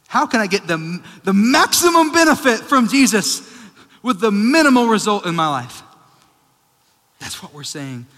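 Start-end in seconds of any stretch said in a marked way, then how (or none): 0.1-5.8 loud, high, impassioned preacher; full-force preaching. Real, not acted. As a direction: thunder it at the congregation.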